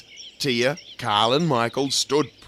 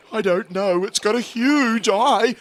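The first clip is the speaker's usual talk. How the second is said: ordinary delivery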